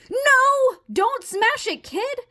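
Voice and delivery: high, panicked cry; alarmed shout. Acted. Frog stops kid smashing fly, alarmed. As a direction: cry out to stop it.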